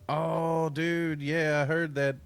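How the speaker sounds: loud excited outburst. Real, not acted. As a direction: burst out with hype.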